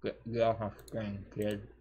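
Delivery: normal talking voice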